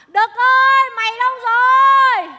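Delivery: loud high holler; shouting for Đực across the field; calling out. Acted. Woman yelling for her late partner, loud holler.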